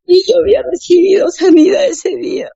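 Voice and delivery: mucho más aguda, con voz quebrada de emoción; cuéntalo casi llorando de alegría; emoción real. Real, not acted. Narra emocionada su curación. (emocionada hasta las lágrimas)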